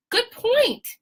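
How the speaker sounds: high, sing-song mock approval; good point said in a high, sarcastic tone; sarcastic praise. Real, not acted. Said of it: "mock agreement".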